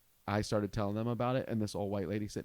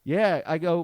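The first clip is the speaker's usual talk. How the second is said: normal talking voice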